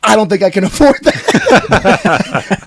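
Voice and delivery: loud, high-pitched mock customer; imitating jerky customer. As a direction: raise pitch and volume, hammed-up retelling. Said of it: "She goes".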